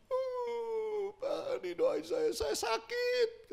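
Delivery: high, tearful pleading voice; crying woman. Retold or imitated. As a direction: beg through tears like a sick woman. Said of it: "udah nangis". (meniru ibu yang menangis memohon)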